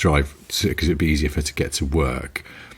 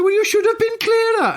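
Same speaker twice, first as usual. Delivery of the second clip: very high huffy Welsh voice; Welsh accent. Retold or imitated. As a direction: answer back in an affronted Welsh lilt. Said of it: "I'm gonna do the accent in a minute".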